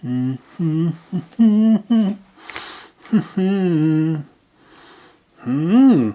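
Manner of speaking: high, loud delighted hums; loud hammed-up mm-hmm. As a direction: ham up the tasting approval. Tasting, exaggerated approval.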